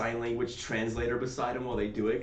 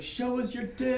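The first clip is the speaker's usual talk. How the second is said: rowdy heckler; 11 semitones higher, slower than usual. Retold or imitated. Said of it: "they're just like".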